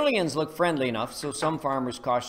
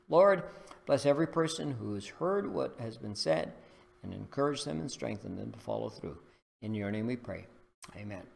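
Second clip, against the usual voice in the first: prayer; 3 semitones lower than usual. Acted. Preacher praying over listeners, reverent. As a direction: pray it reverently.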